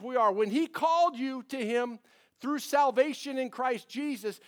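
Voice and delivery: loud, high, driving sermon voice; preaching at full force. Acted. Preacher urging the men in church, loud and high. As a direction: preach it out to the congregation.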